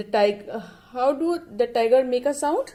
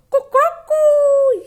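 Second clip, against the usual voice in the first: high, loud cuckoo crowing; rooster crow; 11 dB louder than usual. Shown as a demonstration. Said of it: "And rooster crows".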